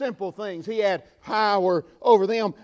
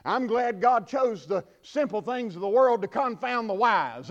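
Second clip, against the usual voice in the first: normal talking voice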